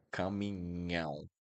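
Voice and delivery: caminhão with nh and a nasal ão ending; Portuguese nasal ão. Shown as a demonstration. Teacher models caminhão, nh plus nasal ão. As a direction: end caminhão through the nose. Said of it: "That's how we pronounce truck in Portuguese".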